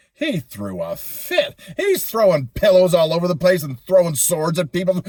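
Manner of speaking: usual speaking voice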